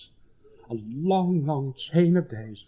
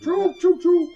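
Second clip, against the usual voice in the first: mock train noise; 4 dB louder, slower than usual. Mocking choo noise at table.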